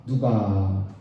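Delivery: loud, slow unison scripture reading; slow congregational reading. Real, not acted. Pastor leading congregation through Hosea, slow loud reading. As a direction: read it out slowly and loudly with the church. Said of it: "같이 한번 읽고".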